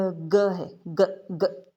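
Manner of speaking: "ग" said as a short, clipped g sound, repeated; teacher sounding out "ग" for the class; phonics sound demo. Shown as a demonstration. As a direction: model the bare "ग" sound for learners. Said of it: "इसका sound जो है वो".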